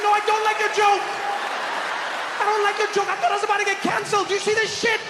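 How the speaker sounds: loud, high-pitched protest; hammed-up indignant yelling; mock outrage. Acted outrage at the waiter's prank.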